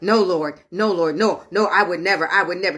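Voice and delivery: low-pitched, imploring denial; low mock-solemn protest; protesting disciple. Peter protests to Jesus, recalled.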